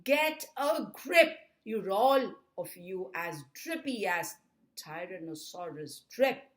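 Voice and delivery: loud opening rebuke, emphatic last word; dad's forceful scolding; exasperated dad. A narrator's reading. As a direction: tell the trembling family off. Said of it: "Dad said".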